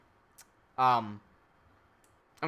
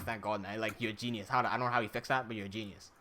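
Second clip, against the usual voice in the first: relieved thanks; 10 semitones lower, 10 dB quieter than usual. Retold or imitated.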